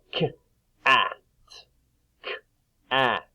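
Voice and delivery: "K-at" broken after the k; sounded out. Shown as a demonstration. Teacher having pupils listen for the middle, "K-at" sounded out. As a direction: say "K-at" in two parts with a gap. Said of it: "listen to the sound in the middle".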